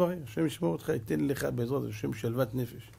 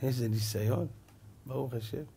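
quiet reply; 3 semitones lower, 9 dB quieter than usual. Retold or imitated. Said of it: "אני אומר".

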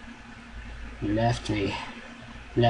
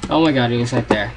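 loud, fast shock; startled outburst; 6 dB louder, faster than usual. Real, not acted. Player who just saw Slendy, startled and rushed. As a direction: blurt it out fast and loud in fright.

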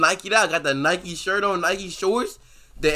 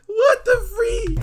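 high, slow, crying out in disbelief; shocked laughter; 4 dB louder, slower than usual. Real, not acted. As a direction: squeal it in shocked delight. Reacting to the video.

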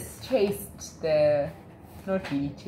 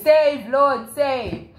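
higher, louder plea, acted; desperate cry for help; 7 semitones higher, 7 dB louder, much slower than usual. Sinking Peter crying out to the Lord, higher and louder.

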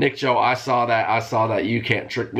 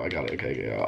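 usual speaking voice

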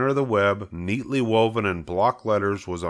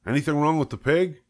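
higher, brisk farmer voice; farmer Zuckerman asking; 7 semitones higher than usual. Acted. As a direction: ask what's wrong, mildly concerned.

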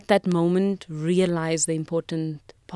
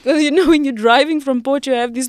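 louder and higher, laughing through the story; laughing retell; 6 semitones higher, 8 dB louder than usual. Real, not acted. Telling the podcast host a funny memory of her late aunt, laughing. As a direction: giggle as you recall the aunt's joke.

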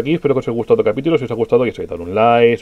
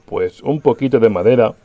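ordinary delivery

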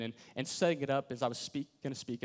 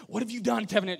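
higher and louder parental yell; mock yell; 8 semitones higher, 7 dB louder, faster than usual. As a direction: call the name out angrily. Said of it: "unless they're yelling your name".

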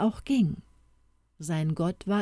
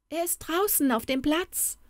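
put-on much higher excited little girl; girl calling out that Toni is outside; 11 semitones higher than usual. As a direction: blurt it out eagerly. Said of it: "rief Elsa aufgeregt".

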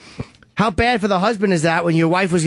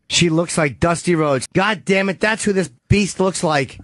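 ordinary talk, nothing marked

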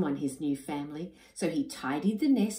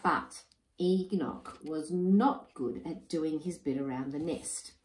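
usual speaking voice

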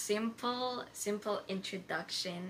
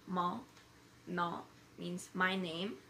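usual speaking voice

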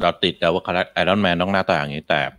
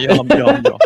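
ยอม ยอม ยอม squeaked much higher and louder; squealed surrender; 6 dB louder, faster than usual. Real, not acted. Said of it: "กูยอมแล้ว กูธงขาวแล้ว". (ร้อง ยอม ยอม ยอม เสียงแหลมสูงและดังขึ้น)

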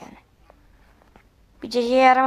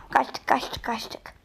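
softer relieved whisper; 7 dB quieter, much slower than usual. Real, not acted. Gamer escaping the neighbor, whispering relief. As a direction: breathe it out as you slip away.

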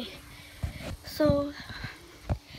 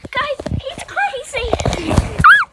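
shouting; 9 semitones higher, 10 dB louder, slower than usual. Real, not acted. Being bitten, yelling.

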